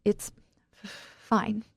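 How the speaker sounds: halting, mildly tearful reply; falter, then force the word out; through tears. A narrator's reading. Wife reassuring visitor, haltingly through tears. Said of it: "I manage through my tears".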